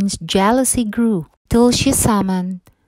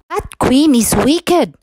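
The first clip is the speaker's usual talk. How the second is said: higher, louder dwarf voice shaking their heads; disapproving dwarfs; 6 semitones higher, 4 dB louder than usual. A narrator's reading. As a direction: tut at the wicked queen. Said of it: "they said".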